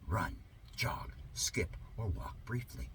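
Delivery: whispered list. Acted. Reading mock mandates aloud, host whispers the list. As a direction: whisper the forbidden moves.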